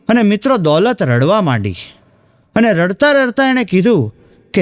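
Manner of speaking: ordinary talk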